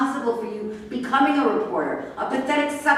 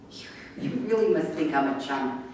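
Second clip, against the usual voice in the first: normal talking voice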